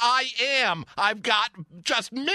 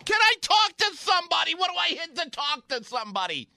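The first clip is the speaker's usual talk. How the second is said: exasperated demand; 6 semitones higher, faster than usual. Acted. Demanding a human operator.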